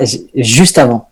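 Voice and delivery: usual speaking voice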